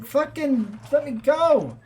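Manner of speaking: put-on high, loud pleading Brooklyn accent. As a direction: beg in a Brooklyn voice. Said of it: "This is Brooklyn voices on, on again".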